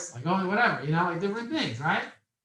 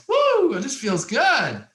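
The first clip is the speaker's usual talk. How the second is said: a very high, loud woo, then a slow, savoring line; whoop, then relish it; gleeful woo; 10 semitones higher, 7 dB louder, much slower than usual. Retold or imitated. Young man earning three hundred an hour, whooping with glee. Delight at charging big money.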